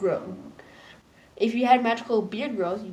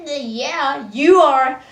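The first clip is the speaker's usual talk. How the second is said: higher, louder teasing retort; mock sarcastic reply; 5 semitones higher, 10 dB louder, much slower than usual. Friend teasing nervous Marco, sarcastic retort. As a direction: toss it back like a cheeky friend.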